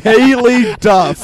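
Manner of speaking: slow, drawn-out mocking. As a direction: stretch the name out to tease.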